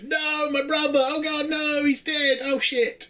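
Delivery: high-pitched hammed-up wail of grief; mock crying. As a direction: ham up a sobbing brother. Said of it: "Kirk then cries and goes".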